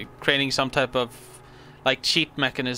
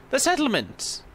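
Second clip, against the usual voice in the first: excited; 10 semitones higher than usual. Real, not acted. Spots the goal in game.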